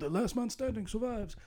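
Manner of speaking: mock announcement. Quotes the game's announcement.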